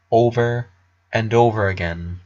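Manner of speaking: plain reading voice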